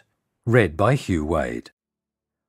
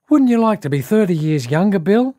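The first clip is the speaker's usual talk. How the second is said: high, louder mimicked boy's voice; little boy; 11 semitones higher, 5 dB louder than usual. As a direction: pipe up like an eager little boy. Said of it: "when a little boy came running up".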